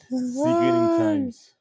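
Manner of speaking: high, drawn-out squeal of the phrase; sickening times. Real, not acted. Podcast host squealing the catchphrase, high and slow. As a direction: stretch it out in a high delighted cry.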